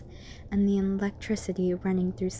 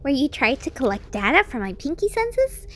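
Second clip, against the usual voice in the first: high, bouncy, curious; squeaky chirpy question; Pinkie voice; 6 semitones higher than usual. Acted. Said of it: "Pinkie spoke out".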